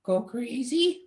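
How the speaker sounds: high, slow mock Marge question; Marge asking. Marge asking Homer if he will go crazy, high and slow. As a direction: stretch it out high and puzzled. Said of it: "And Marge is".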